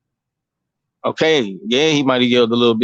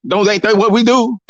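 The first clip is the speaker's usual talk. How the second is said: put-on sulky kid; 5 semitones higher, slower than usual. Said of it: "He like".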